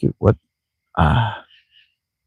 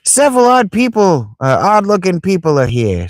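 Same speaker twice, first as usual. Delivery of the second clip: high kid voice with mock Italian accent; hammed-up Italian-accented youngster; little kid, Italian accent; 12 semitones higher than usual. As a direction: pipe up as an Italian child. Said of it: "says that little kid in an Italian accent".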